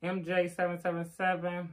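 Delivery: ordinary talk, nothing marked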